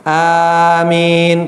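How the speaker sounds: amen stretched out very slowly; overlong amen. Shown as a demonstration. Teacher mocking a drawn-out amen to pupils. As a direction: drag out the amen like reciting a verse. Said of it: "كأنكم تقرأوا آية".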